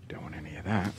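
hushed aside, barely voiced; soft voiceless mutter at the ads; whisper. Real, not acted. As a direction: whisper it like a secret to yourself. Aside about unwanted adverts.

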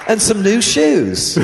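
giggling hammed-up British joke; British accent. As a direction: tack on a silly posh line while laughing. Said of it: "She did it this morning at breakfast".

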